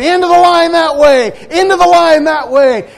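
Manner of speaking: loud, high crowd-herding holler; airline lady calling out. Retold or imitated. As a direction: bellow directions over a packed terminal. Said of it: "this lady from this airline is saying".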